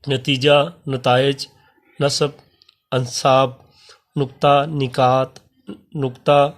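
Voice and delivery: slow, clear reading of each Urdu word with pauses; careful word drill. Shown as a demonstration. Teacher reading plural forms to class, careful drill. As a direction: read each word crisply for students to copy.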